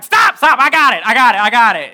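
loud, high urgent yelling; frantic stopping. Retold or imitated. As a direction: cut her off in a mock panic. Frantic husband stops her calling.

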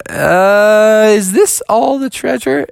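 loud, high drawn-out "uh"; acted let-down incredulity; underwhelmed. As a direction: question it in high disbelief. Froggy let down by the treasure.

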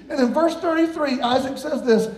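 ordinary talk

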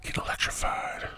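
soft, unvoiced whisper; whispered joke. Real, not acted. Host jokes in a whisper about lightning. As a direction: drop to a whisper at the end.

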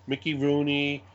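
ordinary talk, nothing marked

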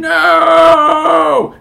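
huge, high mock movie "no" scream; hammed-up anguished scream. Host acting out the classic fall-to-your-knees moment, hammed-up scream. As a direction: scream it long, high and loud in melodrama. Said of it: "fall down to your knees and scream".

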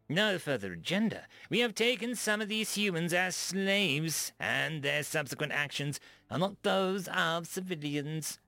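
a touch of outraged diplomat; indignant alien ambassador. A narrator's reading. Dak ambassador retorts.

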